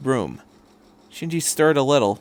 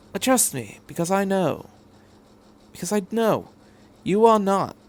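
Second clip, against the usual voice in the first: sincere reassurance; 6 semitones higher than usual. Acted. Doctor assuring Shinji he is no coward, sincere. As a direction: speak it as a promise.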